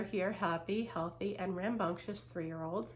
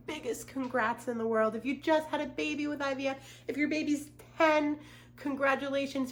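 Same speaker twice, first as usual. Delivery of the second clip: higher, louder, quick cheering; excited congratulations; 6 semitones higher, 4 dB louder than usual. Real, not acted. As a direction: burst out with joyful congrats.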